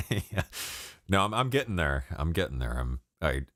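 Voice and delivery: usual speaking voice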